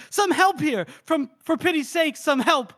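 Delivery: hammed-up cry for help; mock call for help. Casca bursting from the tent, calling for help. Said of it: "He goes".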